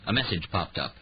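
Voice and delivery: plain reading voice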